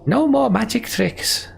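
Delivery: high, slow, drawn-out threat; angry warning. A narrator's reading. Ollie with axe at Juno's neck, angry warning. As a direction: draw out the warning, pitched high. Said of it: "Ollie hisses".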